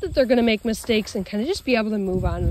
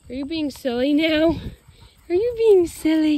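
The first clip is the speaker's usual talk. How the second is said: higher, louder teasing for the dog; playful teasing; 6 semitones higher, 6 dB louder than usual. Real, not acted. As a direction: tease the silly dog fondly.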